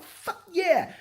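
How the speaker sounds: pitched high, eager for the fight; eager fuck yeah. Retold or imitated. Vlogger imitating himself accepting the fight, pitched high and eager. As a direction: jump at the chance to fight.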